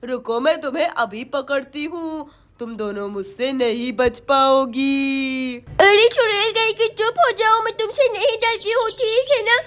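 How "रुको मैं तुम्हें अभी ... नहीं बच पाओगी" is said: low, slow threatening witch. Acted. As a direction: warn them as the witch. Witch chases the girls.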